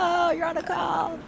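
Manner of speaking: lightly voiced dawning realization; mock surprise. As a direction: act like you just caught on. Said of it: "then you're like".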